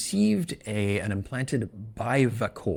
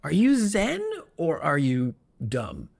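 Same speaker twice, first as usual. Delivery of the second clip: baffled question; 6 semitones higher, slower than usual. Retold or imitated. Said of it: "You're like".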